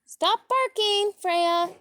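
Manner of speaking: sharp high call to the dog. Real, not acted. Owner calling her barking dog to stop.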